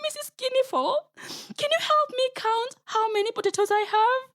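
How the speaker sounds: high, wailing crocodile tears; fake crying. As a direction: sob it out like a trickster faking grief. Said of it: "Anansi began to cry".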